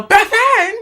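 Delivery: high, loud yell of a name; calling out. Retold or imitated. Friends spotting her at the party, loud high call. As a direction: shout her name across the crowd.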